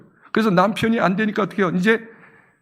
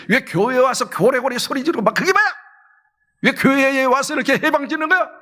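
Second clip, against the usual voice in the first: mild acted scolding, pitch a bit raised; 4 semitones higher than usual. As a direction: tell them off as the indignant priest. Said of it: "그렇게 막 나무라 합니다". (나무라듯이)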